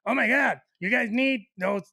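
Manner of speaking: lightly put-on exclaiming voice; lightly mimicked woman. Retelling advice on wasp traps, mildly hammed-up exclamation. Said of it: "And she goes".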